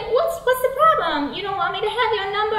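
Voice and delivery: high, pushy wheedling; insistent pickup voice, speeding up; creepy flirt. Acted. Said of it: "really creeping me out".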